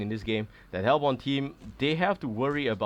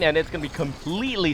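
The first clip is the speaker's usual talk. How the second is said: ordinary delivery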